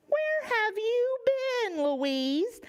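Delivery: high, slow fussy hen; old hen voice. Acted. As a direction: ask like a worried old hen. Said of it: "asked an old hen named Monique".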